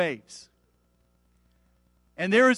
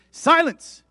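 loud, high burst of command; commanding rebuke; 10 semitones higher, 10 dB louder than usual. A narrator's reading. Jesus rebuking the winds and waves, loud command. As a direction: order it out forcefully.

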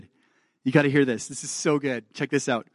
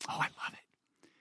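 whispered delight; 16 dB quieter than usual. Real, not acted. Excited aside before scripture.

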